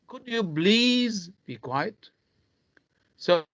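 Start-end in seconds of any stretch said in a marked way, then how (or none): 0.1-2.1 "please" stretched out and raised high; slow down and draw out a high "please"; stress on PLEASE. Shown as a demonstration. Teacher stressing important words, long high "please". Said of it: "I'm stressing on, uh, words which are very important".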